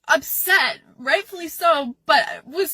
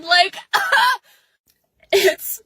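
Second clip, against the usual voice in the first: crying laugh; 5 semitones higher, 4 dB louder than usual. Real, not acted.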